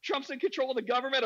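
high-pitched, insistent disbelief; acted incredulity. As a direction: mimic a true believer appalled by doubt. Acting friend's incredulous insistence.

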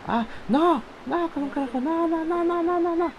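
high, drawn-out wail of no; despairing wail. Real, not acted. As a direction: wail as it all goes wrong. Falling again, wailing no.

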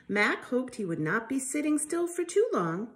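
ordinary delivery

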